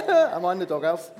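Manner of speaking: laughing through the joke; sheepish laughing question. Real, not acted. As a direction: chuckle the pun out. Laughing joke about wife.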